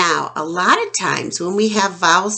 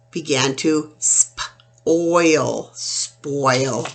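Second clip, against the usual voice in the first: slow; 4 semitones lower, much slower than usual. Shown as a demonstration. Rotten apple, slowing down.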